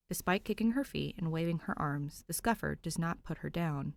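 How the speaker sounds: ordinary delivery, nothing marked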